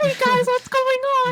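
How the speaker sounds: put-on very high, loud squeaky kid voice; mock a ten-year-old greeting the lobby; little kid gamer.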